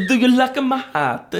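put-on Italian accent cracking up; mock Italian accent. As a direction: giggle through a hammed-up Italian accent. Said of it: "how to speak in an Italian accent".

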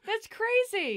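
excited disbelief; real amazement. Real, not acted. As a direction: marvel at the wild coincidence. Reacting to the coincidence.

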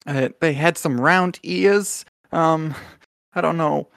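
high little mouse voice, nervous; a small creature unsure of what it saw; timid mouse messenger. Acted.

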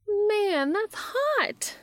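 very high excited exclamation; Charlie. A narrator's reading. Charlie reacts to Dean being carried, excited high exclamation. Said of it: "Charlie exclaims".

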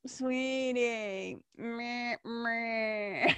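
a lightly imitated whiny complaint to her partner. Wife imitating her own whining at her partner, lightly whiny. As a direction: whinge at him like a sulky child, lightly. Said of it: "I'm so whiny".

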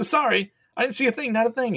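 high, embarrassed backing-off apology; flustered squeak. Acted. Maid caught walking in on the couple, squeaking an apology. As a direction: pretend you saw nothing, voice jumping up.

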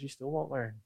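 a gently amused God. Retold or imitated. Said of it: "God laughs and goes".

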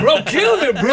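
egging the lawyer on from the box; excited cheer. Retold or imitated. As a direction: juror cheering the attorney on, loud and hyped. Cheering on the defense attorney.

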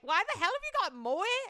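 high, loud demand in disbelief; hammed-up astonished outburst; incredulous. Guest spots champagne in the fridge, incredulous.

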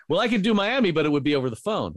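lightly hammed-up publicist dangling an option; mock manager voice. Publicist on the phone offering Miami, mock sing-song.

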